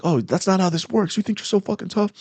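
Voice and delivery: high-pitched mocking retort, retold; mock-belligerent put-down; taunting cop. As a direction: imitate a cop talking down to someone. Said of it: "he just talks shit to me".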